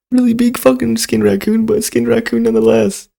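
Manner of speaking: ordinary delivery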